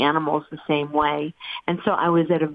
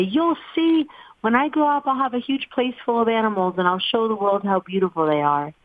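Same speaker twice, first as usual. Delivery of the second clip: high-pitched mock yell, easing down toward usual pitch; retold yelling back; 6 semitones higher than usual. Child answering parents back about animals, mild mock yell. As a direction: imitate a defiant child's outburst, not truly shouted. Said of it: "I would yell back at them".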